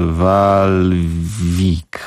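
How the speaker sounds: Walwik sounded out slowly, syllable by syllable; unsure pronunciation. Real, not acted. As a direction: stretch out Walwik like an unfamiliar foreign name. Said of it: "jak to się czyta".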